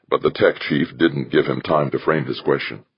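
plain reading voice

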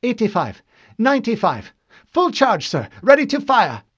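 high military report building to a shout; lieutenant announcing. A narrator's reading. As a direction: call readings with rising urgency. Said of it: "Lieutenant Hikawa announced".

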